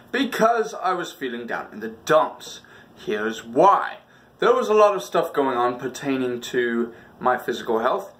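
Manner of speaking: ordinary talk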